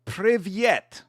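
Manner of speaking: Privyet tried carefully in a put-on Russian sound; Russian hello attempt. Shown as a demonstration. Learning to greet the Russian guest in his language, careful try. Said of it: "you had to tell me how to say hello in Russian".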